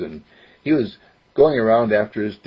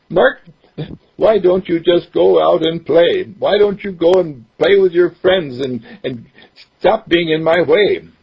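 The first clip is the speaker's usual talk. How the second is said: dad voice; 7 semitones higher, 4 dB louder than usual. Retold or imitated.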